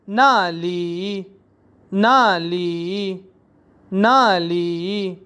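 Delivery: नाली drawn out very slowly; teacher drilling slowly; Chinese pronunciation drill. Shown as a demonstration. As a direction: stretch each syllable of नाली. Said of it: "अगला है देयर".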